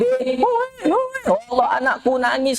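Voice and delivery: mock panicked mother hearing baby cry; lightly voiced worried mother; mother calling out. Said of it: "kata dia".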